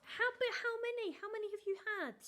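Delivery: mock-exasperated mother shouting the question; exasperated mum. Mother demanding an answer from her son, exasperated. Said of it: "And she was like".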